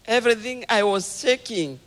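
ordinary delivery

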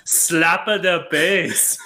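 loud, high, drawn-out Jamaican impression; hammed-up Paul Rudd Jamaican; mock Jamaican accent. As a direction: stretch it out in a bad Jamaican accent.

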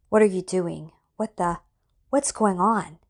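loud, frightened outburst. A narrator's reading. Alex hearing doors lock, loud and frightened. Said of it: "Alex was frightened".

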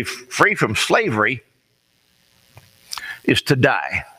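ordinary delivery

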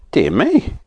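mild high-pitched astonishment; a touch of startled wonder; surprised. A narrator's reading. Saucepan man reacting to the toffee shock, surprised. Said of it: "even more surprised".

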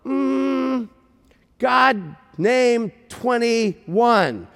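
high, very slow, word by word; struggling to explain. Retold or imitated. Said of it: "he's, like, struggling".

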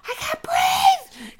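very high, drawn-out mock wail; retold panicked scream. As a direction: scream as if smothered, acted. Retold panic under a mask.

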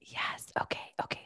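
whispered explanation. Shown as a demonstration. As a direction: whisper so you don't jinx it. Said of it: "I'm gonna whisper".

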